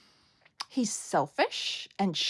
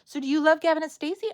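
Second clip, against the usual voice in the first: ordinary delivery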